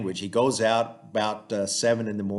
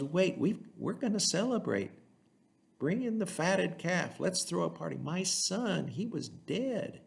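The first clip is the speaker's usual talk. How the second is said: much higher, eager cry of celebration; overjoyed dad throwing a party; joyful father; 9 semitones higher, 3 dB quieter than usual. Retold or imitated. Father welcomes son, cuts him off.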